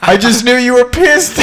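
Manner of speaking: loud, high shout breaking into laughter; laughing punchline. Retold or imitated. Fighter confessing to his coach's dad, laughing shout.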